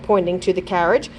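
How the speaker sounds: usual speaking voice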